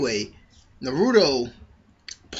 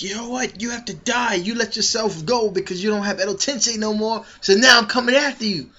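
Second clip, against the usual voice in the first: higher, cold, deliberate threat; Sasuke threatening; 7 semitones higher than usual. Retold or imitated. Sasuke confronting Madara, higher threatening voice. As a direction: tell him he has to die, pitched up. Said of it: "he's like".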